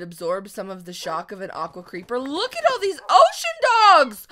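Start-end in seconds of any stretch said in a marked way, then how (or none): 2.2-4.3 loud, high-pitched excitement; thrilled outburst. Real, not acted. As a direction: call it out in sudden delight. Spots a pack of ocean dogs.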